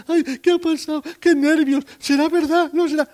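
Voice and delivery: very high-pitched, put-on overwrought voice; flustered mother. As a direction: play a breathlessly agitated mother, up high. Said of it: "porque estaría sofocada".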